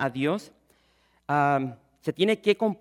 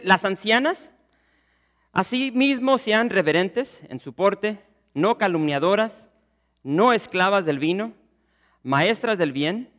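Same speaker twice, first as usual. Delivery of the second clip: louder, solemn reading of the verse; scripture reading; 3 semitones higher, 4 dB louder than usual. Acted. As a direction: read the Bible text aloud to the congregation, measured and raised. Lectura del texto bíblico. (lectura solemne y en voz más alta del pasaje bíblico)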